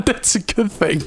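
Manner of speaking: high, giggly echo; laughing. Real, not acted. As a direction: say it through real laughter. Echoing the reassurance while laughing.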